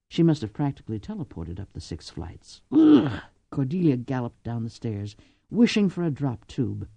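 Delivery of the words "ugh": high, loud grunt of disgust; disgusted ugh. Acted. Lady reacting to climbing six flights, a disgusted ugh. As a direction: spit out a fed-up ugh.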